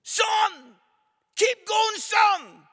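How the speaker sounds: very high, loud cheering shout; dad cheering his son at the marathon, a loud high shout. Retold or imitated. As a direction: yell encouragement from the roadside. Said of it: "his voice shouts".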